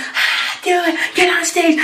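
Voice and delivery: high-pitched imitation of a cheering crowd; wild crowd. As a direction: egg them on like an excited audience. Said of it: "the crowd goes wild".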